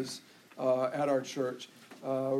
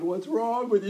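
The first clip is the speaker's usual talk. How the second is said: a touch higher, louder complaint to God; louder mock outcry; 5 semitones higher, 4 dB louder than usual. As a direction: cry out to God in frustration. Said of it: "Moses begins to cry out with God".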